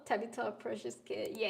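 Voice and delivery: ordinary delivery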